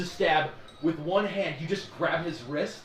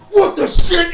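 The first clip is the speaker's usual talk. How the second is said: much higher, louder startled outburst; shocked shout; 13 semitones higher, 16 dB louder, slower than usual. Acted. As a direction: blurt it out in shock. Patrons shocked.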